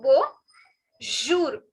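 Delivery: bonjour drawn out slowly, n swallowed; nasal French bonjour. Shown as a demonstration. Teacher showing the nasal sound in bonjour, slow demonstration. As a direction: stretch "bonjour" syllable by syllable, no hard n. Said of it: "it has a nasal sound".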